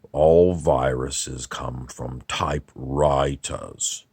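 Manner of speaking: slow, drawn-out American accent, put on; William S. Burroughs. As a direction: draw out each word in a mock-American author voice. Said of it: "if we were going to do Burroughs, he would tell you".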